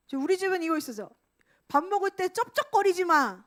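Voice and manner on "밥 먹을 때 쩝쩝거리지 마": loud, higher nagging scold; nagging parent. Retold or imitated. A parent at the dinner table loudly nagging a child. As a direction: nag about chewing noises.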